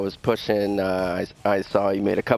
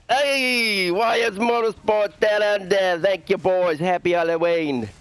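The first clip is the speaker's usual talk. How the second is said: high-pitched, badly faked Aussie accent; attempted Australian accent; 11 semitones higher, slower than usual. Driver thanking teammates on request, in a bad Australian accent. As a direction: put on a hammed-up Australian voice, pitched well up. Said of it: "do your best, uh, Sandown Australian accent".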